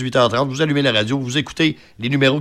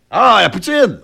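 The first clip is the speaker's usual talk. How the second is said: surprised realization; 7 semitones higher, 5 dB louder, slower than usual. Real, not acted. Guessing the charade answer.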